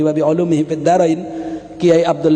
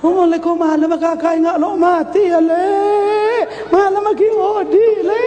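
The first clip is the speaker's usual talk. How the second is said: higher mock-sad voice of a mourner; lightly voiced mourner; 10 semitones higher than usual. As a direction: play a muddled mourner, pitched up. Another mourner answering wrongly. (suara pelayat yang agak dibuat-buat)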